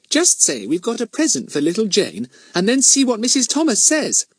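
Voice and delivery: put-on high, eager child voice; Betts, confident little girl. A narrator's reading. Little girl coaches her nervous friend at the gate, confident. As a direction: tell the plan like a keen small girl.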